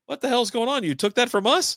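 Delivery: high-pitched, baffled mock outrage; retold incredulity. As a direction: act out a bewildered man demanding answers. Retold time traveler's outraged astonishment.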